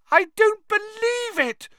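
high disbelieving scream. Acted. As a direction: scream it in shock. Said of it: "Hermione screamed".

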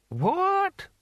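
very high, rising one-word squawk. Acted. Mad Hamish fails to catch the answer, high querulous query. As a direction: pitch the question right up.

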